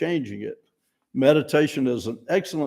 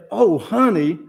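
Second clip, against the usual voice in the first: higher, louder fond teasing; fond oh honey; 6 semitones higher, 5 dB louder, slower than usual. Shown as a demonstration. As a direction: lift it up playfully. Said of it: "I can't say".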